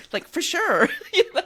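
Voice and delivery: light, laughing Valley girl imitation; a hint of Valley girl slang, laughing. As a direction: toss it off like a teen Valley girl, half joking. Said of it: "I talked like a Valley girl".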